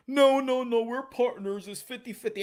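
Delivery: mock voice of a partner insisting on fifty fifty. Imitating a partner's objection.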